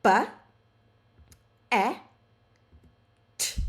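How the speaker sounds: "Ba", "a", "t" each on its own with pauses; sound by sound. Shown as a demonstration. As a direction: tap out "Ba, a, t" one sound at a time. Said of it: "draw sound dots for every sound".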